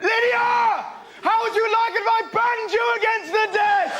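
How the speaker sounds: screaming, high and loud; teacher's yell. Retold or imitated. Teacher yelling at a girl banging her calculator. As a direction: bellow it like a furious teacher. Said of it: "our teacher went".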